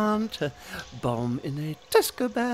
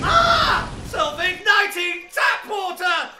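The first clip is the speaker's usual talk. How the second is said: high loud startled yell; shocked shout; 7 semitones higher, 4 dB louder, slower than usual. Acted. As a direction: shriek at the flaming tap. Tap water bursts into flame.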